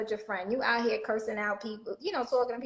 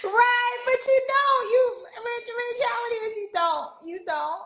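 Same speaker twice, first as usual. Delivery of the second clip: emphatic agreement; 12 semitones higher, 5 dB louder than usual. Real, not acted.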